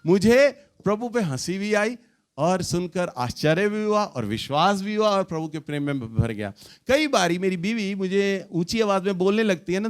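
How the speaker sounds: ordinary delivery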